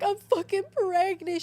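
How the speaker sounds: high, slow, drawn-out wail; mock crying. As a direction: act out tearfully breaking the news to mom. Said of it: "I was literally crying".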